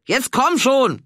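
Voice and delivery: loud, high, impatient shout; impatient call. Acted. Renate yells at Inge to hurry, loud and impatient. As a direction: hurry her along from far off.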